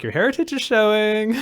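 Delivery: high, loud mock teasing; teasing friends. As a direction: poke fun as the friends would. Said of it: "Everyone's all like".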